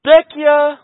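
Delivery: loud, high street cry; vendor's call. Retold or imitated. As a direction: call out for broken things. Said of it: "He's just saying".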